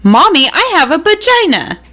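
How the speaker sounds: high, chirpy little-girl imitation; chirping child. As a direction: pipe up like a toddler. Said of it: "niece chirps up".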